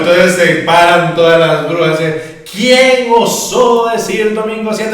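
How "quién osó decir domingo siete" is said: angry witch voice. Acted.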